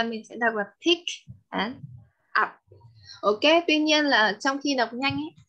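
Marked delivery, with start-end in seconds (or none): 0.9-2.5 pick and up apart. Shown as a demonstration. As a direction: say "pick" and "up" as two separate words with a gap. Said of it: "mình đọc tách hai từ ra".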